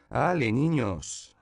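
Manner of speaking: high, loud, slow call. A narrator's reading. Flore calls the children away, loud. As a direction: call the kids over.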